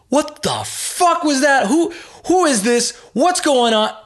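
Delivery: high, slow furious yelling on the phone; mock outraged caller; angry caller. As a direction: blast it like a livid stranger calling back.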